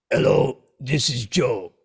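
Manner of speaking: put-on light Austrian accent on the phone greeting. Answering the phone to a nervous young bassist, lightly Austrian-accented. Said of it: "what I now know is to be the [name] accent".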